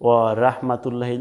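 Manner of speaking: short "ওয়া রাহমাতুল্লাহি" with open "ra" and "lla"; wrong light rah. Shown as a demonstration. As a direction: say "ওয়া রাহমাতুল্লাহি" unstretched, thin r. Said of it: "এখানে লেখা আছে". (পাতলা রা দিয়ে ভুল উচ্চারণ)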